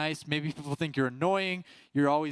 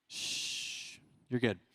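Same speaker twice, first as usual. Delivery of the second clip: hushing aside; 5 semitones lower, 7 dB quieter, much slower than usual. Real, not acted. Hushing kids mid-sermon.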